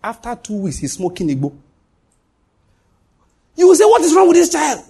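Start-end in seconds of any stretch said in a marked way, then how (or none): 3.5-4.8 high, loud cry of exasperation; mock-parent outburst, pitched way up; exasperated parent. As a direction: exclaim it like a parent at wit's end. Voicing an exasperated parent's complaint.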